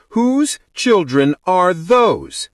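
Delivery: slow, high, emphatic demand; insistent demand. A narrator's reading. Bride presses bridesmaid about the children, insistent. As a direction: stretch out each word insistently.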